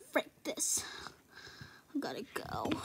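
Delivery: hushed and fed up; whispered giving up. Real, not acted. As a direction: whisper it and bail. Whispered exit.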